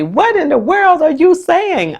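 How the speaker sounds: high-pitched mock astonishment; amused disbelief. Pupils reacting to teacher's odd sounds, amused disbelief. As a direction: imitate tickled pupils, voice jumping up. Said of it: "they laugh and say".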